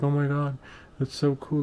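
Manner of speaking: high, gushing imitation of an excited wife. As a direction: imitate a wife thrilled by a find. Voices wife finding the ad.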